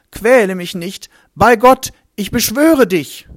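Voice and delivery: high, loud, frantic plea; the kneeling madman begging Jeschua for mercy, loud and high; desperate begging. Acted. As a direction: beg on your knees in terror.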